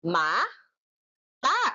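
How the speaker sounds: "Ma-ta" split into two beats; two separate syllables; clapped syllables. Shown as a demonstration. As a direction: clap each syllable. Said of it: "Ipalakpak nyo nga".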